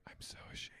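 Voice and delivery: tiny voiceless whisper; Sarah Jessica Parker. Retold or imitated. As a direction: whisper it as a shamed confession. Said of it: "very Sarah Jessica Parker in The Family Stone".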